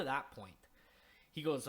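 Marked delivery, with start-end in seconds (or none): none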